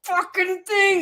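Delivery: high, loud, drawn-out mock indignation; hammed-up offended rival; Cory Booker. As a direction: stretch it out in an outraged high voice. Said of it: "Cory Booker just is like, looking at".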